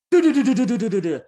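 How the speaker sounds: high, loud tumbling "do do do" noises; thudding falling imitation. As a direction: mimic a body bouncing down stairs. Imitating fall down stairs.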